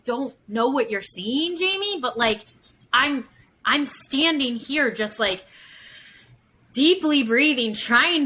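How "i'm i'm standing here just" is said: normal talking voice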